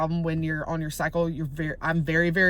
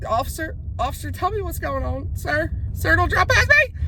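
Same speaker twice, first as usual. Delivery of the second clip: calling out; 8 semitones higher than usual. Real, not acted. Calling to passing officer.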